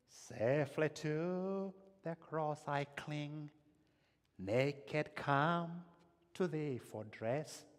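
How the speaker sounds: slow, drawn-out hymn lines rising in pitch; hymn recitation. Acted. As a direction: recite the hymn slowly, as worship. Recites hymn Rock of Ages.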